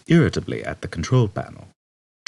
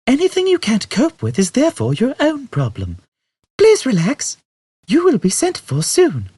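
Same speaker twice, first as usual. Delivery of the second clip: Tannoy announcement; 6 dB louder than usual. A narrator's reading. Ship announcement to new passengers.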